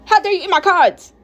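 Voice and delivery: high-pitched outraged shouting; furious yell. A narrator's reading. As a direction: yell at the culprit in fury.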